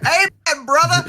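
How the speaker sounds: high, loud congregation-style cheer; mock hallelujah shout. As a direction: holler it like an overjoyed churchgoer, playfully. Acts out what they'd shout back.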